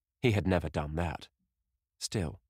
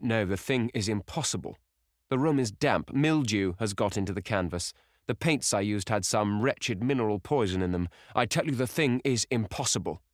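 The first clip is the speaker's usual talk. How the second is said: higher, insistent protest; agitated denial; 4 semitones higher, 3 dB louder than usual. Acted. Painter refusing to believe the portrait has changed, agitated denial. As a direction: grasp urgently at explanations. Painter frantically denies what he sees.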